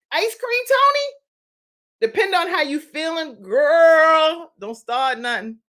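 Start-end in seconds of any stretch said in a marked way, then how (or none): 0.1-4.5 high, loud teasing banter; high-pitched playful surprise. Real, not acted. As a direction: tease her back with a laugh.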